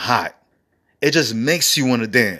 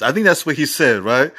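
ordinary talk

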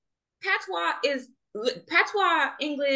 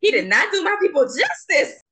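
higher, louder indignant protest; emphatic outburst; 6 semitones higher, 6 dB louder than usual. Real, not acted. To her cohost about the actor's accent, loud indignant protest. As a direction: defend her people, raising the voice.

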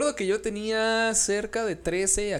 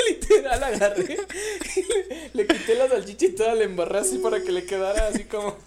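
talking through real laughter; can barely get the words out for laughing; laughing while telling the story; 3 semitones higher, 3 dB louder than usual. Real, not acted.

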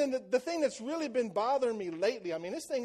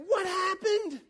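much higher, louder frustrated yell; sideline yell; 10 semitones higher, 6 dB louder, slower than usual. Retold or imitated. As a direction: shout at the kid from the sidelines. Parent yelling from the sidelines.

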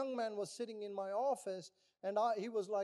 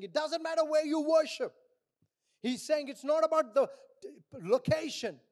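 loud, raised-pitch sermon delivery; forceful preaching; 5 semitones higher, 8 dB louder than usual. Real, not acted. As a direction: proclaim the scripture to the whole congregation. Preaches and reads scripture forcefully.

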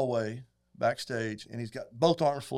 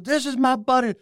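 [name], excited; 11 semitones higher, 7 dB louder than usual. Retold or imitated. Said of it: "he goes".

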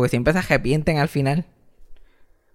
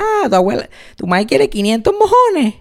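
teasing grandma voice; 9 semitones higher, 3 dB louder than usual. Retold or imitated.

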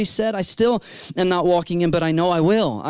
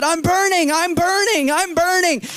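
much higher, urgent repeated cry; teen cries out to the praying woman, much higher; 11 semitones higher than usual. Retold or imitated. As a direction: call out as if on fire. Retold crying out on fire.

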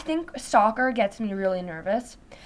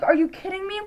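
high-pitched incredulous retort; retold indignation; 7 semitones higher than usual. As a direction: reenact being mad, pitch up without extra volume. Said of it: "so mad and, like, angry".

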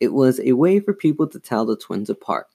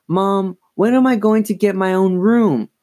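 high-pitched exasperated whine to Mom; 9 semitones higher, 4 dB louder than usual. A narrator's reading.

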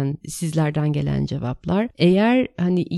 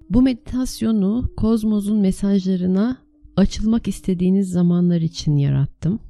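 guided meditation; 3 dB louder than usual. Acted. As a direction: lead them calmly into the meditation. (sakin rehberli meditasyon sesi)

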